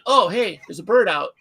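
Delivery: re-enacted alarm at the loose bird; startled reaction. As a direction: blurt it out in alarm. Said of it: "that was my reaction".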